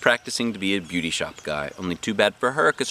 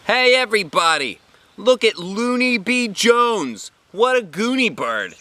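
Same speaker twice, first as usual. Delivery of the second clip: high, loud taunting shout; mean boy shouting; 12 semitones higher, 5 dB louder, slower than usual. A narrator's reading. Mean boy Jim mocking Junie B to class, taunting shout. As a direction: jeer at her across the classroom. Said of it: "he shouted".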